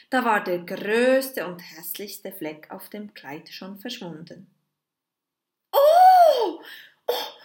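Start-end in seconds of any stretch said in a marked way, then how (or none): 5.7-7.3 put-on very high, loud amazed child; the girl marvels as the stain vanishes. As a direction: gasp in wonder like a little girl. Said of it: "staunte Marei".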